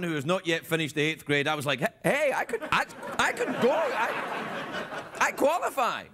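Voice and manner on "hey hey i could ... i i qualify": high, halting dawning realisation; a touch of hammed-up eager surprise; mildly mock excitement. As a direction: stammer it eagerly, pitched up, as if just realising. Acts out realising he is eligible.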